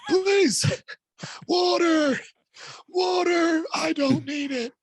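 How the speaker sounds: put-on high, strained, croaking old man. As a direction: beg for water like a frail old man. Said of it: "I turned into, like, an eighty-year-old man".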